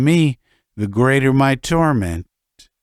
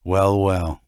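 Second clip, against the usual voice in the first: usual speaking voice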